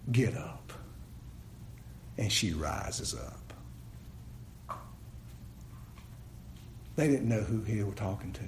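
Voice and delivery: very low, soft storytelling; low, quiet preacher's hush; hushed awe. Real, not acted. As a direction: let it sink almost to a murmur. Hushed telling of the miracle.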